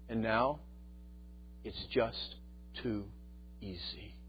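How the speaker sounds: hushed, halting acted admission; imitating a father's subdued confession; quiet confession. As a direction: let it sink softly, with long pauses between words. Voicing father's shamed confession.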